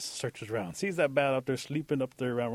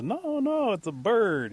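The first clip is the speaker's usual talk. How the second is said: high, louder insistent objection; emphatic disagreement; 11 semitones higher, 6 dB louder, much slower than usual. Acted. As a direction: contradict with pitch shooting up. Eagle argues with bear.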